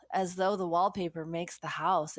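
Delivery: normal talking voice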